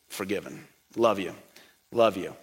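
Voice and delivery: gentle welcome. Pretend. Jesus welcoming a forgiven believer, soft and tender. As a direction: whisper it tenderly like a loving welcome home. Jesus greeting him at heaven.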